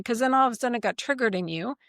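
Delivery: ordinary delivery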